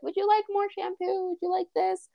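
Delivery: high-pitched polite offer; high, lilting customer-service voice; helpful shop staff. Retold or imitated. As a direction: imitate an eager attendant checking in. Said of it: "they still check in and are like".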